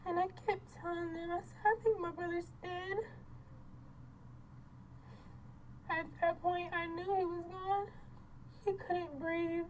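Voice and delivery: high, subdued voice close to tears; tearful. Real, not acted. Telling of her brother's death, tearful. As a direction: hold back real grief, voice lifted high.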